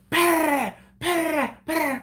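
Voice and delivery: imitating tommy guns; mock machine gun. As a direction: rattle it out like gunfire.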